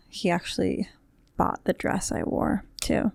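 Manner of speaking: hushed whisper; breathy secret whisper, not wanting to be overheard; whispering an aside to the audience. Real, not acted. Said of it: "I don't know why I'm whispering".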